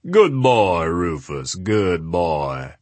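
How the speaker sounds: lightly voiced booming small man. A narrator's reading. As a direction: praise the dog in a big voice. Adams voiced, deep booming.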